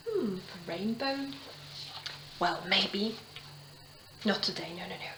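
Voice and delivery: usual speaking voice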